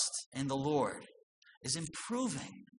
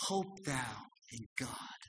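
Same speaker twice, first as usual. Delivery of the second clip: soft reassurance; 3 semitones lower, 8 dB quieter, slower than usual. Shown as a demonstration. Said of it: "The answer should come".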